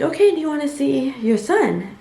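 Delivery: light cheerful nurse voice. Retold or imitated. Voicing the nurse.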